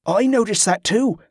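keen underling offering information; eager sailor. A narrator's reading. Sailor chimes in to superior.

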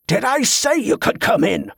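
high angry roar. A narrator's reading. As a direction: roar it at the boy. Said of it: "Ambrose roared".

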